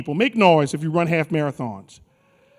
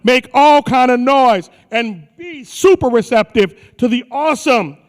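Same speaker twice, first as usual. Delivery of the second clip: announcer intro; 3 semitones higher than usual. Acted. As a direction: announce him like a ring announcer. Introducing speaker to crowd.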